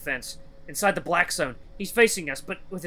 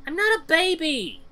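put-on very high little boy's voice; protesting child; 13 semitones higher, 3 dB louder than usual. Boy mocked as a baby, high indignant protest.

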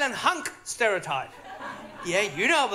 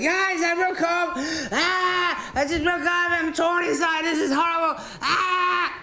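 higher, slow, hammed-up despair; mock heartbroken; 7 semitones higher, slower than usual. Telling his Australian mates about the breakup, hammed-up higher wail. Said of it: "I thought, like".

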